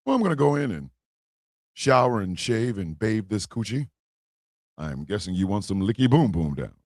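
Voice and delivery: a hint of a sultry woman teasing; woman's voice. Woman voiced in his story.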